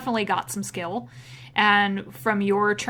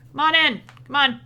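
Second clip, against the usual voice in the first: raised voice, a bit higher; mild yell; 5 semitones higher, 4 dB louder, faster than usual. Acted. Half-orc smith to visitors over his hammering, moderate yell. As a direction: call them in over some noise. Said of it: "yells at you over the sound".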